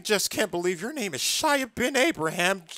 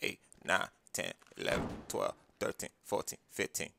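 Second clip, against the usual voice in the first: quiet; 10 semitones lower, 11 dB quieter than usual. Acted. Counting quietly under breath.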